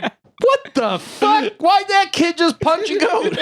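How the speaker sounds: very high, slow mock outrage; shocked onlookers. Onlookers after a kid punches a goat, high mock shock. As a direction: gasp it like scandalized parents. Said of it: "everybody's just like".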